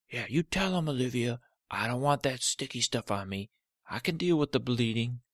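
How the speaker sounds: a cranky toe refusing the Band-Aid; stubborn talking toe. Acted. Cut toe protesting to Olivia, loud and grumpy.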